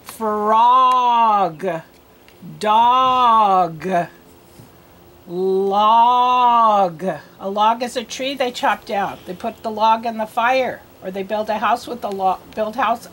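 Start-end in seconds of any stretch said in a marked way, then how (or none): 0.0-7.0 frog, Dog, Log each stretched long and loud; slow, emphatic rhyming word drill; word sounded out. Shown as a demonstration. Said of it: "we're gonna do these words first".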